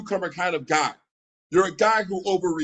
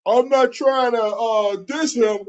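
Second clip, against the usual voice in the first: higher, louder mocking voice of his excuse; mocking whiny quote; 5 semitones higher, 5 dB louder, slower than usual. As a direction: mimic him as a fake-innocent excuse maker. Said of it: "Anytime you say".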